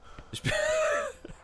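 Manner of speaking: high mock sob; hammed-up falsetto fake whimper; fake crying wail. Shown as a demonstration. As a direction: squeak out a hammed-up fake cry. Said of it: "This is woman fake crying".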